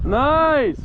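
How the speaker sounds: loud, high cheer; excited shout. Real, not acted. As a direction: cheer the catch. Mom caught a fish.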